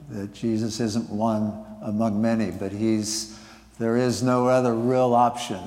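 normal talking voice

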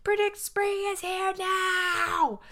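high, slow hammed-up shout; groundhog shouting. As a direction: proclaim it like a proud groundhog. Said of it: "keeps on shouting until his voice is hoarse".

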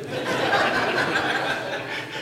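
a real, airy high-pitched laugh at the mix-up; high breathy laugh. Real, not acted. Pastor laughing at being called John, high airy laugh. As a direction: burst into a high laugh of disbelief.

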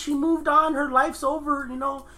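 getting riled up over the plot, voice climbing; heated rant. Real, not acted. Friend ranting to friends about the plot, pitched high and heated.

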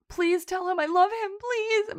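high-pitched mock begging; imitated tearful pleading. Sister begging her brother over the phone, mock-tearful pleading. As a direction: plead desperately, rising on the last please. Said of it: "she's crying so hard".

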